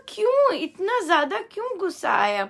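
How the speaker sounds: high, quick astonished why; surprised question. Retold or imitated. Questioning a child at book club, surprised high question. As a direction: ask the child in mock amazement.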